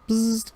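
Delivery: high electronic bzzzt; comm badge buzz.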